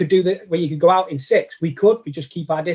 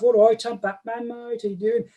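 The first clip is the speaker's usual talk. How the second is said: friendly mock Brummie greeting; imitated Brummie accent; 5 semitones higher than usual. Teasing mate James about his Brummie Batman, imitated Brummie accent. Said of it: "who does Brummy".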